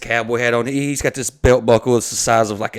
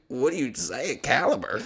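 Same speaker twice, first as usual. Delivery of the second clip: ordinary talk, nothing marked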